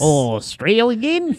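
drawn-out mock Australian accent; slow, lightly done Australian accent. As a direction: stretch it slowly, Australian accent. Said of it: "Australian voice".

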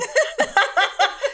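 high, loud burst of laughter. Real, not acted. Laughing at the son's dinner-table joke, loud and high.

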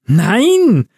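high-pitched drawn-out protest; horrified. A narrator's reading. Elf pleading with tipsy Santa, horrified. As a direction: cry out in dismay. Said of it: "redete Liv entsetzt auf ihn ein".

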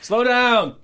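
high-pitched yelled warning; mock shout. Shouting out to people driving while they listen, mock warning yell. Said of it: "keep shouting out things".

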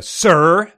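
loud, high, overpronounced "Sir". Acted. Becker questioning a supposedly deaf man, overpronounced. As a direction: address them as if hard of hearing. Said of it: "overpronouncing his words as if he was speaking to a deaf person".

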